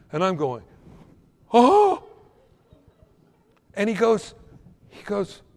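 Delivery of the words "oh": high, loud startled "oh"; acted shock. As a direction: re-enact a sudden shocked gasp. His shocked reaction in the story.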